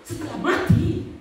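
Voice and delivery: high, slow, forceful challenge; emphatic. Real, not acted. Preacher challenging critics who wait until after death, emphatic. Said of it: "Bahkan saya pertegas lagi".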